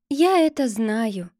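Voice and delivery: usual speaking voice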